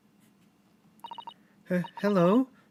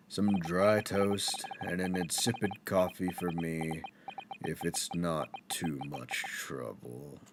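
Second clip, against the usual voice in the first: low, slow, gloomy mutter; depressed Sholmes; 9 semitones lower, 9 dB quieter, slower than usual. Acted. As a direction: sigh it out like nothing matters. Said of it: "he's depressed".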